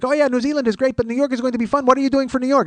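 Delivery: high-pitched eager small talk; chatty seatmate. Retold or imitated. Passenger quizzing comedian about trip, chatty seatmate. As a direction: chatter away at a captive neighbor.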